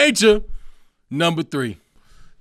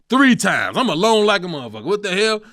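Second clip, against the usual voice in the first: normal talking voice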